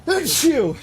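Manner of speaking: a sudden, loud, real sneeze. Real, not acted. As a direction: sneeze hard. Sudden sneeze mid-play.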